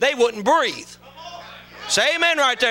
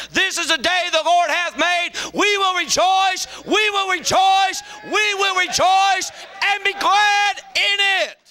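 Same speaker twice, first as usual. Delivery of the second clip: preaching shout; 7 semitones higher than usual. Real, not acted. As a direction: proclaim it to the rafters. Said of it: "can I preach just a second".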